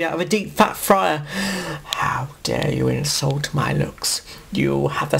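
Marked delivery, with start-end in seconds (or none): 1.8-5.2 offended hiss. Acted. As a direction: hiss it through wounded pride. Davros offended, hissing.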